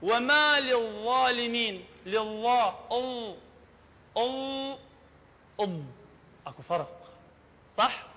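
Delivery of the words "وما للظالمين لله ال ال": slow وما للظالمين with a lighter ظ; الظالمين بظاء مخففة. Shown as a demonstration. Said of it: "بس هاي نقول".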